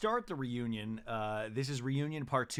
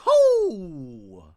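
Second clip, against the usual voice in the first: loud high whoop; mock pop-star whoop; 5 semitones higher, 12 dB louder than usual. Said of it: "Gina goes".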